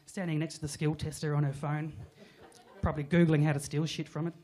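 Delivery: ordinary talk, nothing marked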